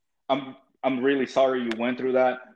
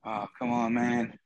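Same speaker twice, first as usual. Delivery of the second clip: ordinary talk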